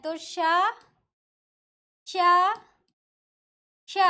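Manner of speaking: teacher sounds out each sh separately; three sh sounds. Shown as a demonstration. Teacher demonstrating the three श to students. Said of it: "हमारे हिंदी वर्णमाला में तीन श हैं".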